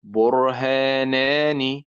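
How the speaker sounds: drawn-out slow example word; slow demo word. Shown as a demonstration. Teacher slowly sounding out برهانان for students. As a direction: stretch each syllable of برهانان slowly for the class. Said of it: "يعني مثلًا كلمة".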